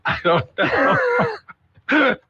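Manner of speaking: laughing. Real, not acted. Laughing at anchor bit.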